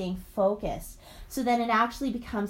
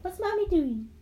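playful, higher-pitched parent voice; 9 semitones higher than usual. Real, not acted. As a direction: coax a little one to answer. Mother prompting her toddler.

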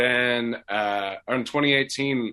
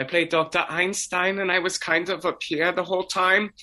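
high-pitched German character voice; German-accented doctor squeaking along in a raised register; 8 semitones higher than usual. Shown as a demonstration. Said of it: "I got to have, like, a German accent, talked, like, an octave higher".

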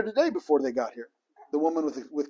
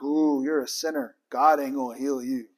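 mock judgmental; 7 semitones lower, 5 dB quieter than usual. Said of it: "it's not like".

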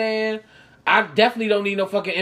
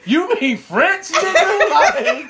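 laughing incredulous; 8 semitones higher, 5 dB louder, much slower than usual. Real, not acted. Laughing at friends' correction.

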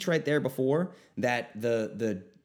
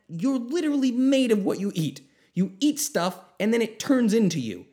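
higher and louder, hammering each point; fired-up insistence; 6 semitones higher, 4 dB louder than usual. Real, not acted. Podcaster to listeners about food, fired-up and insistent. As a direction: preach it like it matters most.

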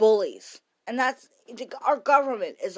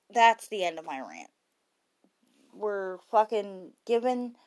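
ordinary talk, nothing marked

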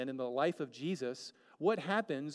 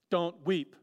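harsh command; 3 semitones higher, 8 dB louder than usual. Retold or imitated. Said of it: "isn't it kind of mean of Jesus".

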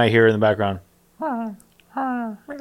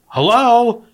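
high, louder puzzled hello; raised puzzled receptionist hello; 11 semitones higher, 5 dB louder than usual. Retold or imitated. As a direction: pitch it up and push the hello. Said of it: "And she goes".

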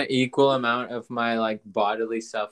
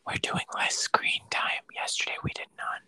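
quick hushed whisper, rushing the words; hurried whisper so he can't hear; frantic whisper; 5 dB quieter than usual. Real, not acted. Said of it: "I have to whisper 'cause he can hear me".

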